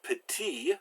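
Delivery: petit in French with the last t silent; silent final consonant. Shown as a demonstration. Teacher giving an example word, petit with a mute final t. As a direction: end petit on the "ee" vowel, no final t. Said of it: "final consonants are usually silent".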